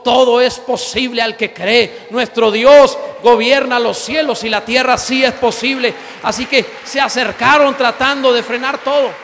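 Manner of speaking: loud, fervent preaching to the congregation; loud sermon shout; full-force preaching. Acted. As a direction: proclaim it loud like a revival preacher.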